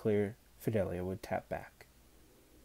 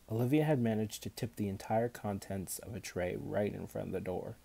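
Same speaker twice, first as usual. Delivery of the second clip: ordinary delivery, nothing marked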